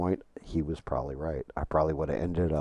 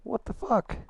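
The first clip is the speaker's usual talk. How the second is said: much higher, startled what-the; disbelief. Real, not acted. Streamer stunned the game froze, high disbelief. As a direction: react in shock at the screen.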